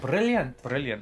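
Scottish "brilliant" with rolled r; David Tennant. Retold or imitated. Showing how actor talks, Scottish accent. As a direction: imitate a Scot saying brilliant, rolling r. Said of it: "when David Tennant speaks and talks, he's like".

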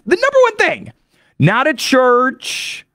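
very high-pitched insistence; emphatic hype. Real, not acted. Podcaster insisting it's not a church, high emphatic hype. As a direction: hammer it home, high and incredulous. Mock-amazed hype about drinking.

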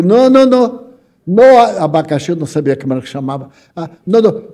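mock exasperated customer; retold flustered refusal. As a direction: wave off the wrong juice, getting flustered. Frustrated customer refusing pineapple juice.

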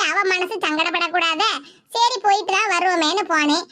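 normal talking voice

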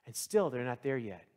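ordinary talk

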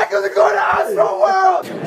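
whooping with excitement; real excited shout. Real, not acted. Friend celebrating surprise Astroworld tickets, excited shout. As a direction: cry it out, overjoyed.

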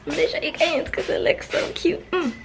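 high, airy gush of delight; cutesy excitement. Real, not acted. Showing viewers the finished filming room, cutesy excitement. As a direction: squeak it out, thrilled with the cuteness.

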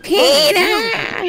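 high, whining cartoon wife call; nagging cartoon wife impression; Lois Griffin. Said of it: "do you want a Lois Griffin".

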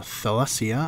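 usual speaking voice

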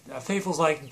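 ordinary delivery

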